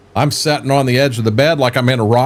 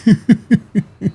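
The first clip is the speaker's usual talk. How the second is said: laugh; 9 semitones higher than usual. Real, not acted. Laughing at own joke.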